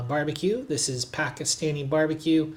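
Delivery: ordinary talk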